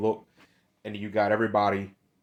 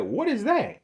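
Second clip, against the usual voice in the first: mock shocked squeal, pitched way up; retelling the woman's reaction with a high disgusted squeak; disgusted what is that; 12 semitones higher than usual. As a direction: imitate her recoiling in disgust. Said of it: "And then they immediately said".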